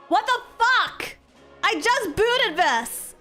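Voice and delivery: high, slow, loud disbelief; outraged shriek. Real, not acted. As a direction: squeal it out in outrage. Game demands update.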